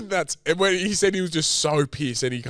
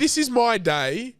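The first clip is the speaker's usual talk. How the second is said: mock bride; 8 semitones higher, slower than usual. Voicing the upset bride.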